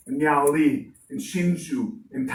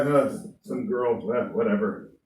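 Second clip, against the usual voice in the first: dismissive president; 8 semitones lower, 4 dB quieter than usual. Acted. President ignores the girl.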